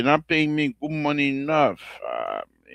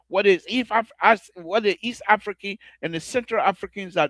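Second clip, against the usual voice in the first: heated rant; 9 semitones higher than usual. Real, not acted. Host rants at critics.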